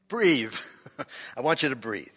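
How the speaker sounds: ordinary talk, nothing marked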